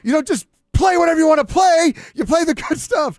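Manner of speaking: high, loud furious shout; angry scolding. Retold or imitated. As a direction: chew out the board op. Theory scolding after the song.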